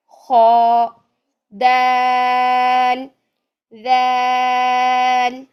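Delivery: each letter drawn out very long and slow; stretched letter recitation. Shown as a demonstration. Teacher recites the Arabic letters drawn out for students. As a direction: recite each letter held for several counts. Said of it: "पाँच छः हरकत तक लेके जाना है".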